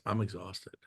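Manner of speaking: low and soft, worn-out sigh of a confession; tired admission. Retold or imitated. Wendy admitting the truth to herself, low and soft. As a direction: let it drop, drained. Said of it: "and she goes".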